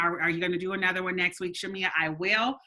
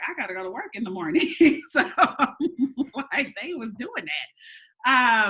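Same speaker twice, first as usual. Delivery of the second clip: quick, laughing excuse; 4 semitones higher, 4 dB louder than usual. Retold or imitated. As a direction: rush it out while laughing. Retold leaving party, laughing.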